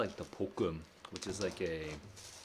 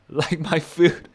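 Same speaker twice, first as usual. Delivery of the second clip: laughing; 9 semitones higher, 11 dB louder, much slower than usual. Real, not acted. Laughing in delight about delivery.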